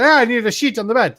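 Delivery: high mock Italian accent, "sheet" like "shit". Italian tourist phoning reception in a high mock accent. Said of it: "the Italian".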